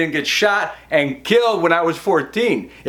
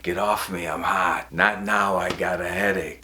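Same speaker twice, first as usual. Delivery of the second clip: low, soft imitation of a wife; quiet, mock-tired refusal; weary brush-off; 10 semitones lower, 5 dB quieter than usual. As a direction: mutter it, wanting to be left alone. Imitating wife rebuffing his advances.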